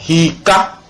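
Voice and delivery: "hika" with the I drawn out; slow model "hika" with long I; long I example. Shown as a demonstration. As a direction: stretch the first vowel of "hika". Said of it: "ça devient I, comme".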